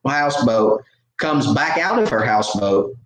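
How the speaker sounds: ordinary talk, nothing marked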